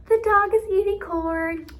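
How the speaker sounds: high, loud mock amazement; high-pitched, slowed wonder at the news; excited modeling. Shown as a demonstration. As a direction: marvel loudly to a small child. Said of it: "I'll model it one more time".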